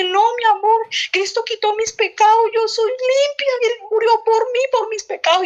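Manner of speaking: high, fervent answer to her son; retold crying out. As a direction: re-enact an elated outcry, pitch held well up. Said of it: "¿Usted por qué grita así?".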